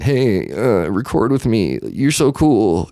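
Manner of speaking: mock an over-eager hanger-on; desperate fawning. Parodying someone begging a guest to record, desperate. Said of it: "reeking of desperation".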